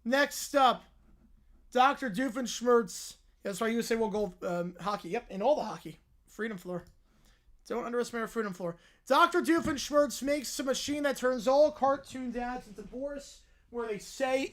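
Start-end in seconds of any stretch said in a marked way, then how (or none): none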